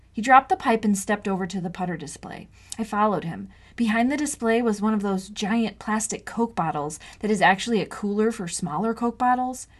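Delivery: ordinary delivery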